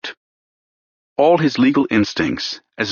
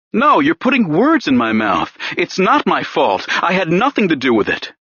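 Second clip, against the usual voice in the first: higher and pleading, slowing on the key words; desperate denial; 6 semitones higher than usual. Acted. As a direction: plead that it's not your fault. Pierce desperately denying.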